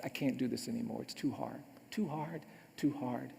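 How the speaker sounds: very soft, low, defeated repetition; retold despair, hushed and sinking; devastated. Lawyer after his client's execution, devastated.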